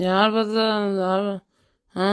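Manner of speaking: put-on slow, slurred drunk mumble; drunken mumble. Drunk husband lying on the road mumbling, slurred and slow. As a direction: drag the words out like a drunk just waking up.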